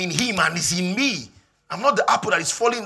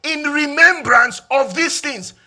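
shouted, much higher and louder preaching; full-force preaching; 8 semitones higher, 11 dB louder than usual. Acted. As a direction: drive the scripture home loudly.

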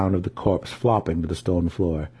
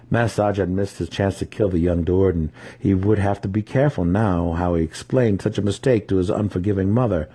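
ordinary delivery, nothing marked

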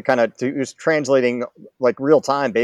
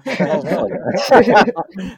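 laughing mock scold; 7 semitones higher, 3 dB louder, slower than usual.

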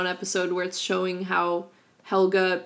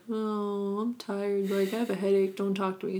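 softer weary mumbling mom; slurred drunk voice; 6 dB quieter than usual. Retold or imitated. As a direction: sigh it out tired and drunk. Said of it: "her mom's always like".

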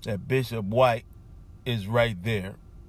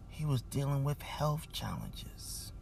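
whisper; 2 semitones higher, 8 dB quieter than usual. Real, not acted. Host moved, recalling illness.

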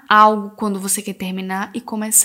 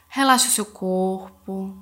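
soothing spa whisper; 3 dB quieter than usual. Acted. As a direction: guide them gently into calm. Leads a guided meditation. (voz suave de meditação guiada)

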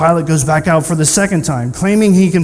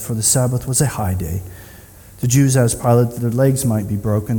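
lower, measured reading voice; solemn scripture reading; 7 semitones lower, 3 dB quieter than usual. Acted. Preacher reading the crucifixion account, solemnly. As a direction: read it gravely to the congregation. Reads scripture passage aloud.

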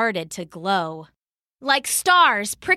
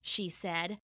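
plain reading voice

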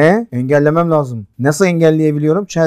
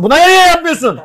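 high-pitched, exasperated protest; exasperated outburst; 11 semitones higher than usual. Real, not acted. Player scolding a rival over a missed move, exasperated outburst. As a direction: let the question jump up in frustration.

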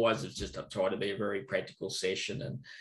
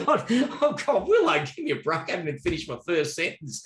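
much higher, loud exasperated cry; mock exasperation; 7 semitones higher, 5 dB louder than usual. Teacher retells his exasperated reaction to Willow's complaint. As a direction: throw up your hands in mock despair.

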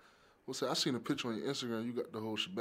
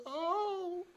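high, strange-voiced exaggerated oh; mock gasp of surprise; 3 dB louder than usual. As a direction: overplay the stunned oh. Reaction to positive covid test.

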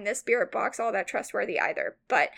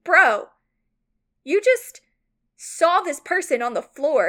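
exasperated; 5 semitones higher, 4 dB louder than usual. Retold or imitated. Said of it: "And I'm like".

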